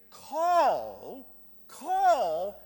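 loud, long-drawn CALL. Acted. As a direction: draw the word out in a preacher's ringing call. Preacher calls out scripture word.

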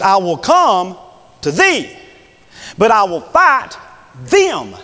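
"thee" and "them" each set apart by pauses and drawn out; slow, emphatic contrast of "thee" against "them"; contrastive stress. Shown as a demonstration. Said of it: "don't take this as being the same people".

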